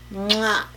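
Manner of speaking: loud smooched "mwah"; kiss sound. Real, not acted. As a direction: blow an audible kiss to the viewers. Blown kiss signing off to viewers.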